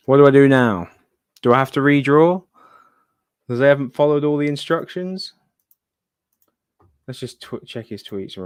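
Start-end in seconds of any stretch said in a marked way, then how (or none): 0.1-5.3 louder, exasperated questions. Real, not acted. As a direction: at a loss, out loud. Winner didn't follow rules.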